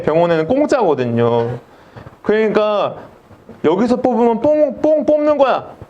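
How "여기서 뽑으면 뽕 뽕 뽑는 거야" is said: high, hammed-up salesman pitch; pushy army dentist. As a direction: sell the free extraction like a bargain. Dentist boasts it's free here.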